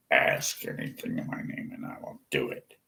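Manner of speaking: whispered verse, the opposite of all caps. Shown as a demonstration. Mocking the all-caps Christians with a soft whisper. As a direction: breathe the words out, no voice at all. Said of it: "Let's just type all caps".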